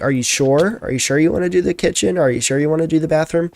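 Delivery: high, fast doubtful questions; quick unsure double-check; incredulous questioning. Retold or imitated. Quoting both asking are you sure.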